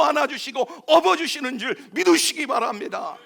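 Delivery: loud, high, building sermon climax; full-force preaching. Acted. Pastor assuring sinners God carries them, full-force preaching. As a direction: drive the promise home to the crowd.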